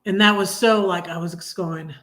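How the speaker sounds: ordinary talk, nothing marked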